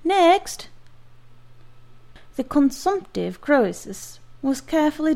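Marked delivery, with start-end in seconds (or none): 0.0-0.7 loud call for the next patient; sharp call down the waiting room. Acted. Assistant calling the next patient, loud call.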